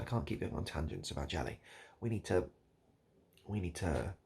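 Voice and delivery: normal talking voice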